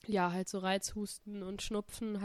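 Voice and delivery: usual speaking voice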